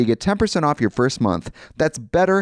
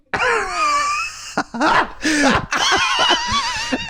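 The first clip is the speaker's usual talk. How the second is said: long high shrieking laugh; screaming laugh. Real, not acted. Cohosts howling at the [name] gag.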